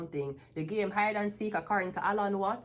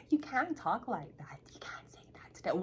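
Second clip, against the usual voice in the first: scandalized whisper, acted out; hushed warning; 5 dB quieter than usual. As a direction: whisper it as a mock warning to stop. Imitates someone hushing the speaker.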